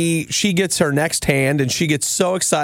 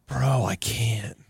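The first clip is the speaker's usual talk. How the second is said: slow, drained and quieter, barely getting it out; worn-out groan; 7 semitones lower, 7 dB quieter, slower than usual. Retold or imitated. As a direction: sag into it like you have nothing left. Exhausted, too tired to go on.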